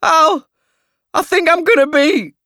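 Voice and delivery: high, loud queasy wail; about to throw up. A narrator's reading. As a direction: wail it as sickness rises.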